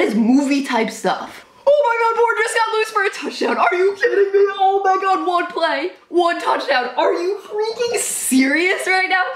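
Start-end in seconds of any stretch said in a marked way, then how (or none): 1.7-9.2 real fan disbelief; shocked excitement. Real, not acted. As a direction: yell it in stunned delight. Another surprise touchdown.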